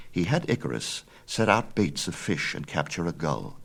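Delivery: ordinary delivery, nothing marked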